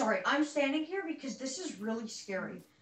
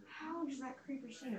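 ordinary talk, nothing marked

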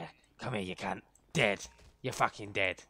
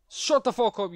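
loud, high snap; angry shout; 9 semitones higher, 8 dB louder than usual. Real, not acted. Streamer at the stupid dog, angry shout. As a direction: yell it to silence them. Cursing a barking game dog.

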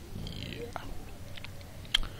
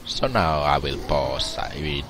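usual speaking voice

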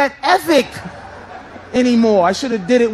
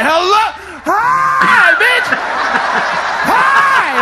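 hammed-up high shout of hello; mock yelled hello; 10 semitones higher, much slower than usual. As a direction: yell it at her like a maniac. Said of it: "You think I said".

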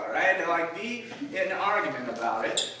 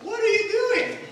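mom cutting in; 13 semitones higher than usual. Retold or imitated. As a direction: play mom jumping into the fight, pitched high.

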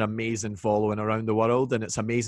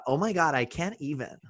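Scot's put-on American accent, higher pitch; mock American accent, pitched up; 4 semitones higher, 4 dB quieter than usual. Shown as a demonstration. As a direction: do a gushing American "oh my God". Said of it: "That's my American. I have several American accents".

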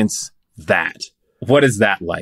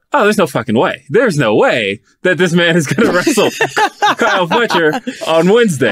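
disbelieving laugh; 9 semitones higher than usual. Retold or imitated. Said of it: "I remember thinking".

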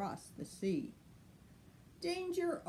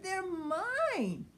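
high, fast, delighted tailor; much higher fast fussy voice; tailor's voice; 13 semitones higher than usual. Acted. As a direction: chirp it out, thrilled with the undies. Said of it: "and then someone said".

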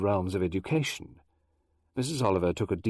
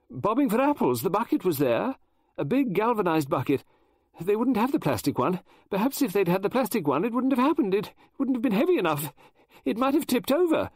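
higher, louder flustered lady novelist; Mrs Oliver voice; 11 semitones higher, 4 dB louder than usual. Acted. Mrs Oliver explaining schools to Poirot, high and eager. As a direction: chatter on like an excitable Englishwoman.

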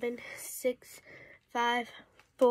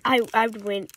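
ordinary talk

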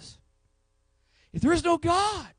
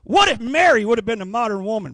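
usual speaking voice

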